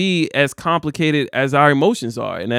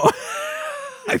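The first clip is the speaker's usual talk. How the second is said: shrieking burst of laughter; 6 dB quieter than usual. Real, not acted. Laughs at finance joke.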